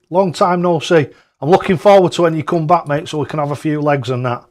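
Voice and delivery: excited greeting. Real, not acted. Streamer greets listener Clive listening from Los Angeles, thrilled and loud.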